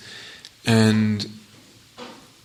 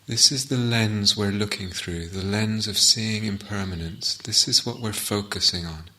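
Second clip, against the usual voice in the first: guided meditation. Acted. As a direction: guide listeners gently inward, phrase by phrase, leaving silences.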